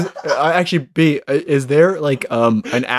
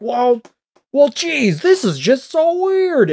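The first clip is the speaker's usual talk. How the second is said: puzzled country guy, pitched up; folksy old man; 7 semitones higher, slower than usual. Pretend. Voicing old driver.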